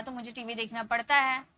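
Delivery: ordinary talk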